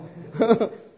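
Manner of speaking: a quick bright laugh; short laugh. Real, not acted. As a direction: laugh at your own story. Laughs while telling own testimony.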